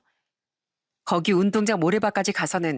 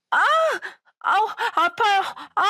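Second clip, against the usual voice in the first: sharp squeals of hurt, pitched way up; pained yelling; 11 semitones higher than usual. Retold or imitated. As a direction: yelp as if thrown to the ground. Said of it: "고래고래 소리를 치면서 아프다고".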